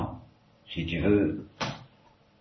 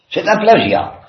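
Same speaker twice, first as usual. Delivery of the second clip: much higher and louder, elated; sudden exalted cry; 11 semitones higher, 7 dB louder than usual. A narrator's reading. Ivan cries out at his brother's kiss, exalted and loud. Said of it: "s'écria Ivan soudain exalté".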